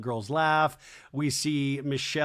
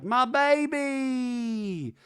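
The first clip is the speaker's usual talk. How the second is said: imitating Brittany; 10 semitones higher, much slower than usual. Flashback, Brittany crying out.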